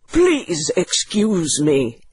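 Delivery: lightly voiced doctor, slow and restrained; quiet answer. Doctor asked by the widow to dance, understated quiet answer. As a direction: decline slowly in an understated character voice. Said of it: "answered the doctor quietly".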